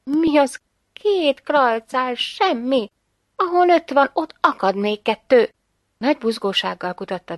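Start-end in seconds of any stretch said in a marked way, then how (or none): 0.0-5.5 raised, lively, lightly excited; eager confidence. A narrator's reading. Mother sure that two more coins will turn up, eager. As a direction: wave the problem away with higher pitch.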